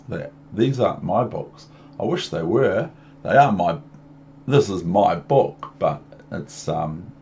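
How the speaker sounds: ordinary delivery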